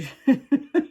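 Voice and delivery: short high giggle, real; laughing. Real, not acted. Host amused by her own bad French, laughing. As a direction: break into a brief high laugh.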